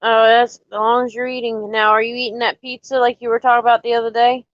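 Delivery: ordinary delivery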